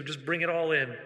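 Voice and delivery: usual speaking voice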